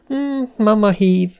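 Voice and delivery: put-on high child voice, mildly dejected; a touch of disappointment; crestfallen. A narrator's reading. Little girl called away by mama, crestfallen. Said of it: "szontyolódott el Glória".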